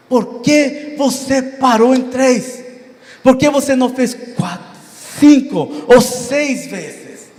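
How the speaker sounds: high-pitched, drawn-out indignant rebuke; angry prophet. Retold or imitated. Prophet rebukes the king for stopping at three, angry. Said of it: "Eliseu ficou com raiva".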